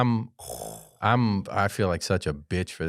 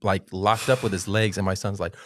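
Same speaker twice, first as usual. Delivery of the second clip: usual speaking voice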